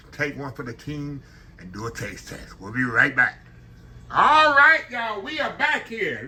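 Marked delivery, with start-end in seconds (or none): 4.1-6.3 excited greeting. Real, not acted. Cheerful return to camera.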